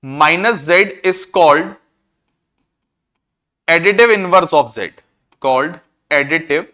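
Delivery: very slow, word by word for note-taking; slow dictation. Shown as a demonstration. Teacher dictates a definition to students, slow and spaced. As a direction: spell it out slowly so pupils can write.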